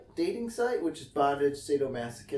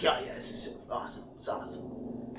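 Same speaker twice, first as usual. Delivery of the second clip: fast, hushed, whispered bragging; 7 dB quieter than usual. Retold or imitated. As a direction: breathe it out like a secret thrill. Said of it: "He's like".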